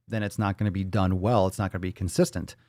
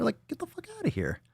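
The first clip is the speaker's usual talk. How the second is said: much higher, much softer, almost a squeak; squeaky mock dismissal; 7 dB quieter than usual. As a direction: toss it off in a tiny high voice.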